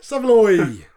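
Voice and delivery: loud, high, drawn-out attempt at a chant; half-believed rowdy call; insincere call. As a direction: holler it slowly without convincing anyone. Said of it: "It sounds so insincere".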